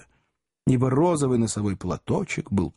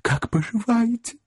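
sugary greeting; 13 semitones higher than usual. A narrator's reading. Job greets sweetly.

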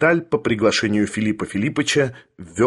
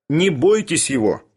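higher-pitched, urgent call; calling after; 5 semitones higher than usual. A narrator's reading. Bormental reassures the departing lady, calling out. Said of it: "крикнул вслед Барменталь".

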